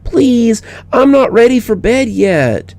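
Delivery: put-on high, slow child begging; lightly voiced little girl begging; pleading little girl. A narrator's reading. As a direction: plead to stay up longer. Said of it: "she begged".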